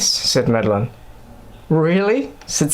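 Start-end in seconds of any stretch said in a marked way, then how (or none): 1.7-2.3 held-back excitement. A narrator's reading. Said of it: "trying not to squeal from excitement".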